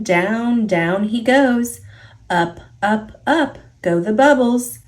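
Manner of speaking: ordinary delivery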